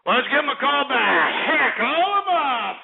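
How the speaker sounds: raucous high-pitched call to action; rowdy holler. Real, not acted. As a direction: whoop it out, stretching the last words. Hollers repeat of call-back order.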